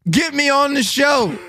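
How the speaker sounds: shouted plea to Howie. Acted. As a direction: call it out big and slow. Calling out to host.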